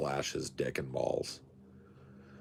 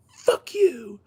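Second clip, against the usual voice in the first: mock meltdown; 9 dB louder than usual. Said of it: "this whole meltdown thing".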